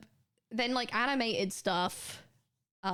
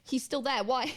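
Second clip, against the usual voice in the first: ordinary talk, nothing marked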